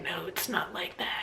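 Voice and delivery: a breathy sneering parody of his excuse; mocking whisper. Mocking Randy's lame excuse to viewers, sneering whisper. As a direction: mimic him mockingly under the breath. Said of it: "been like".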